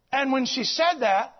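ordinary delivery

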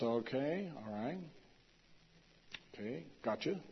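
soft, shrugging okays; indifferent mumble. Retold or imitated. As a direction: mimic a crowd that isn't impressed. Indians' unmoved reply, retold.

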